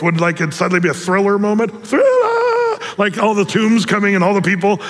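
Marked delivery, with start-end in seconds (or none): 1.9-2.8 comic shriek. Acted. Preacher joking about zombies rising, very high squeal. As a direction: shriek it like a spooky cue.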